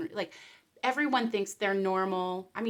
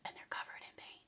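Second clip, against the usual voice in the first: whisper; 17 dB quieter, faster than usual. Real, not acted. Gleeful hushed aside.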